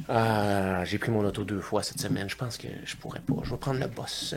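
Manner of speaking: quiet, low, subdued worrier; hushed, downcast mock confession; anxious kid quote. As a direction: murmur it low, as if fretting to yourself. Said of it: "parce qu'il est comme".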